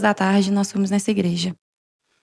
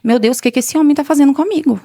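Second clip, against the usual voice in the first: higher, louder astonished plea to God; retold alarm; 5 semitones higher, 5 dB louder than usual. Woman retelling her panicked thought as the pastor prayed, alarmed. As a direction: replay a bewildered inner cry, pitched up.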